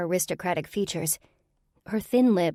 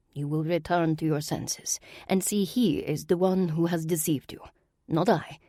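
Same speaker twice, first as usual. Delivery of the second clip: put-on an aristocratic villain in a Russian accent; Russian-accented sorceress.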